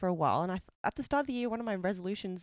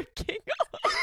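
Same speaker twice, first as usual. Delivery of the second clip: laughing. Real, not acted. Laughing at friend choking.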